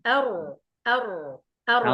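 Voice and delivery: أر tapped at the tip of the tongue; light forward أر; teacher modelling the tip r. Shown as a demonstration. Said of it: "من طرف اللسان".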